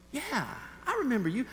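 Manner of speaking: high-pitched, chatty cashier voice; friendly cashier. Retold or imitated. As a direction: imitate a woman happily recognizing someone.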